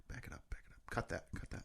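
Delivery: quick whispered asides; whispering. Real, not acted. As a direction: whisper to stay off the take. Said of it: "let me go back".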